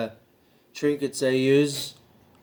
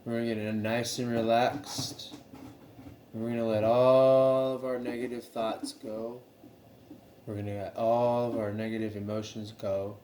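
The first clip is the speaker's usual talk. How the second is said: soft, slightly lowered meditation voice; guided meditation; 3 semitones lower, 7 dB quieter than usual. Acted. Leading viewers to relax and let negative thoughts go, guided meditation. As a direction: ease listeners gently into calm, softly.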